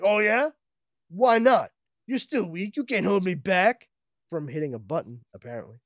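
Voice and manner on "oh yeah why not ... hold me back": high, loud mocking taunt; taunting villain. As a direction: sneer at the weakened hero. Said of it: "He's like".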